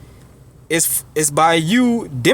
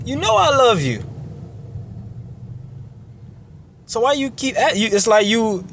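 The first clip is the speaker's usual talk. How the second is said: higher, protesting and baffled; exasperated Peter; 6 semitones higher, faster than usual. Retold or imitated. Peter to the Lord, hurt and exasperated. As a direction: Peter pleading, hurt that he keeps being asked.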